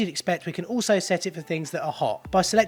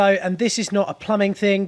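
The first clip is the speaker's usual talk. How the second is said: normal talking voice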